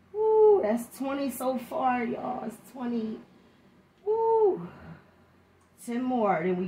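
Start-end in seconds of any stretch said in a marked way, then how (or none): 0.1-0.6 high, loud cheer after reps; excited whoop. Real, not acted. 4.1-4.7 high pumped-up woo; excited whoop. Real, not acted. Cheering after twenty reps.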